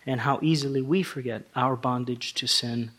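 ordinary delivery

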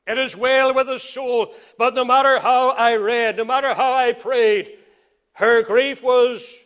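rising, loud pulpit preaching; fervent preaching. Acted. Pastor preaching comfort to a widow, fervent and loud. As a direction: preach it out to the congregation.

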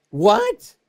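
high squeaky surprised cry; shocked what. Retold or imitated. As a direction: yelp it in disbelief. Disciples react to Lazarus dead.

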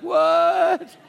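sudden high, loud startled "What?"; imitated surprise. Lazarus pulled back from paradise, startled high yelp. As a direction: play the jolt of being yanked back. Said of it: "You're like".

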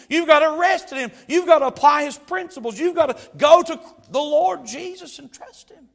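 loud, high preaching at full force; impassioned preaching. Real, not acted. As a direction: build to a forceful, high exhortation.

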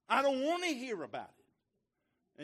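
high, loud, slow whining refusal; mock whiny refusal. Preacher mimicking the stranger refusing the gospel, whiny. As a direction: whine it like a stubborn man.

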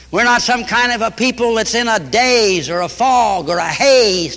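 usual speaking voice

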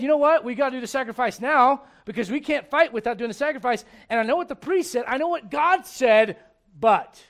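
loud, high pushy crowd voice; a touch of a pleading crowd; mock people's demand. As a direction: egg on like an impatient mob. Saul voicing the people's demands.

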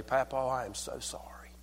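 very soft, tender whisper; hushed and barely voiced; whispered apology. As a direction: whisper it as a heartfelt moment. Grandchild's apology, then hushed response.

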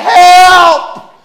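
re-enacted panicked shout, long and high; cry for help. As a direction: yell it loud and drawn out. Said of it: "as I'm doing it, I'm saying".

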